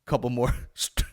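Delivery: loud mock-furious demand; hammed-up rage over pastries; angry about buns. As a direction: aggressively order buns, breaking up with laughter. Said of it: "just getting so angry about buns".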